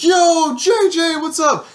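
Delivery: high, loud mock girl greeting; imitating Brittany. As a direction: squeal it out like an excited friend. Friend Brittany greeting him.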